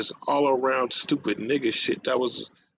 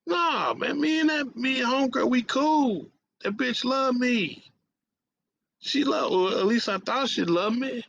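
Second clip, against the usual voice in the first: 1 protesting disbelief; 12 semitones higher than usual. Retold or imitated. Refusing to believe homegirl turned on him, high protesting shout. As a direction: insist they're cool, in shock. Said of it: "I'm like".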